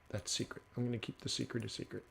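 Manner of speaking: very soft, quick, near-whisper; secretive hush. Real, not acted. Trader withholding a tidbit from viewers, secretive hush. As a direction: drop right down as if guarding a secret.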